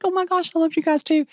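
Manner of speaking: high, gushing mock thrill; gushing delight. Gushing reply to new bosses.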